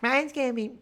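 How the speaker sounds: very high squeaky start to a mock voice; botched impression of Faith. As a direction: overshoot the pitch, then give up. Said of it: "no, that's not how she talks".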